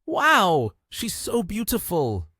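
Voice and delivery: high, slow gush of amazement; amazed exclaim. A narrator's reading. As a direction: let the wow burst out high.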